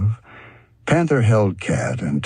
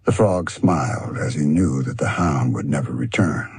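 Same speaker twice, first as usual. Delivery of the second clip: usual speaking voice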